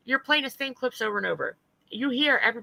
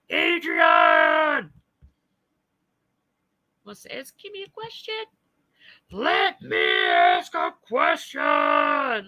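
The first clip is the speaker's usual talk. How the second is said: loud, high, very slow mock shout; mocking her voice; 5 semitones higher, 4 dB louder, much slower than usual. Imitating her thoughts before the panel, mocking shout. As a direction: stretch the question out like a taunt. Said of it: "let me try to do her voice".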